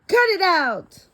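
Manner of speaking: put-on high peeved kid; annoyed kid. Student tells a classmate to stop joking, high and peeved. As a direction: snap back at a joking classmate.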